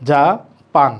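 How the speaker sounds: জাপান said as জা then পান, two separate beats; teacher sounding out two syllables; split into syllables. Shown as a demonstration. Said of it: "অক্ষর রয়েছে দুইটি".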